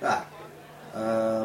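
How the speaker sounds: ordinary delivery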